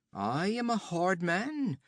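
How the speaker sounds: höher und lauter, prahlerisch spöttisch; höhnischer Räuberhauptmann. Acted. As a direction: ein Wüstenräuber verhöhnt den Gefangenen. Olgerd spricht.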